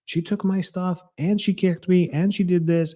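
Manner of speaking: put-on high-pitched child's voice listing grievances; daughter's complaint. Daughter explaining the fight to her parent in a high child's voice. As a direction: tell on your sister in a high kid voice.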